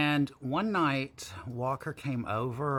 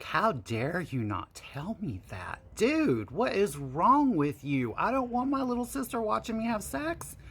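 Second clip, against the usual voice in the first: angry; 9 semitones higher than usual. Retold or imitated. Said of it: "I was pissed at him".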